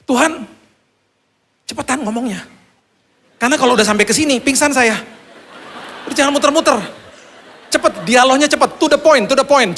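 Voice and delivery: high-pitched, panicky pleading; frantic urging. Retold or imitated. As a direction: hurry God along in desperation. Acts out frantic plea to God.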